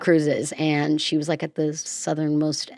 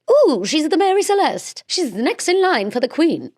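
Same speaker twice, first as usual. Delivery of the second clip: mock regal lady; 9 semitones higher than usual. Host imagines the ship as a royal heir, mock regal. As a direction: play her as a pampered princess.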